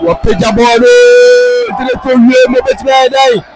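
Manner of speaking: disbelief boiling over; excited shout. Real, not acted. Commentator on a goalmouth scramble, an excited shout.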